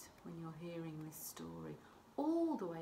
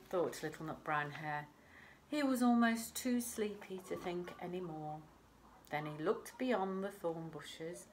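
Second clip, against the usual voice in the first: ordinary delivery, nothing marked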